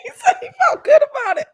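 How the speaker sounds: high, helpless laughter through the words; laughing. Real, not acted. Listener amazed at his admission, really laughing while repeating it. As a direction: squeal it while cracking up.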